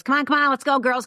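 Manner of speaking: put-on a father calling his girls to hurry, loud and impatient; dad hurrying the girls. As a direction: hurry the family out the door, loudly. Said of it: "My dad comes in the side door and goes".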